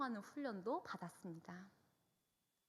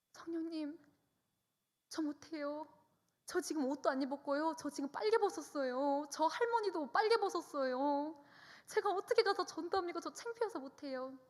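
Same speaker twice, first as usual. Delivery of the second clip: higher, louder embarrassed protest; flustered pleading; 6 semitones higher, 5 dB louder than usual. Retold or imitated. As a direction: wriggle out of it, mortified. Embarrassed refusal to the Spirit. (창피해서 높게 애원하듯)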